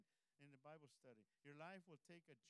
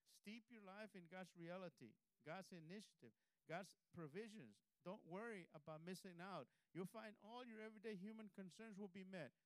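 full-force preaching; 5 dB louder than usual. Acted. Pastor urging the congregation not to worry, preaching louder. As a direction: drive each phrase home from the pulpit.

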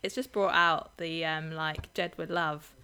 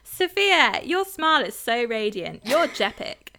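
a higher, louder gushing compliment; 4 semitones higher, 6 dB louder than usual. Pretend. Pretending to be [name] complimenting [name], gushing loud. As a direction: lay on the flattery like a celebrity twin. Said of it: "then they'd be like".

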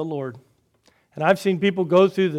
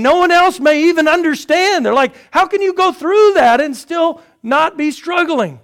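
incredulous question; 11 semitones higher, 9 dB louder than usual. Retold or imitated. Voicing astonished onlookers.

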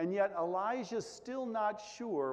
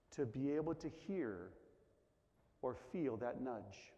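ordinary talk

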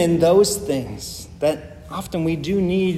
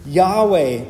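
Yahweh said louder with a falling tune; emphatic Yahweh; 2 semitones higher, 4 dB louder than usual. Shown as a demonstration. Preacher stressing the covenant name, emphatic Yahweh. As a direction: lean hard on the name Yahweh. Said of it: "start with the emphatic".

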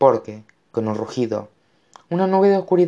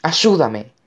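high cry for help; desperate plea; 6 semitones higher, 4 dB louder than usual. A narrator's reading. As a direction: beg urgently, pitched high. Boy begging for help. (ruego agudo de auxilio)

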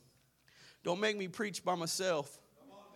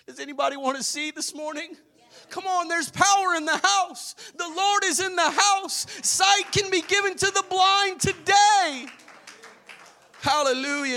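rising, loud preacher's build, higher and louder; preaching at full force; 9 semitones higher, 8 dB louder than usual. Acted. Preacher rousing the Sunday congregation, building to a shout. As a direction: lift the congregation, pushing to a shout.

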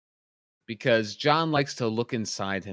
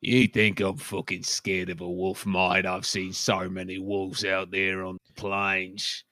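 tough guy; 2 semitones lower than usual. Pretend. Tough-guy wolf impression.

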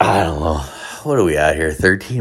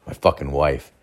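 ordinary delivery

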